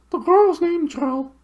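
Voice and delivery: very high owl voice, hooted loudly; high, loud owl call; loud hooting owl. Acted. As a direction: hoot it as loud as you can. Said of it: "as loud as it could".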